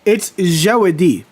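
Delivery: Jawadi, zha-wa-dee, slow and deliberate; correct pronunciation. Shown as a demonstration. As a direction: carefully spell out Jawadi, ja-WA-di. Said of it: "What's the legit pronunciation of my name".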